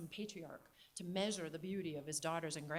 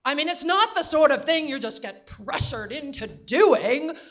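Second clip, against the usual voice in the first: put-on very loud, higher New England accent; 7 semitones higher, 18 dB louder than usual. Grandmother repeating her point to John, very loud New England accent. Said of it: "New England Katharine Hepburn accent".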